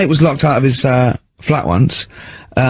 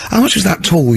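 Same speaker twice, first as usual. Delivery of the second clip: sarcastic; 6 semitones higher than usual. Retold or imitated. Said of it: "I was sort of being sarcastic".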